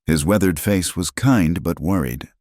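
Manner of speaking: ordinary delivery, nothing marked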